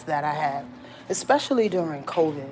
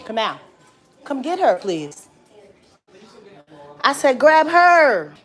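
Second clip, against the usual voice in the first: calling out; 6 semitones higher, 4 dB louder, slower than usual. Real, not acted. Calling for someone to grab baby.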